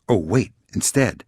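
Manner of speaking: ordinary talk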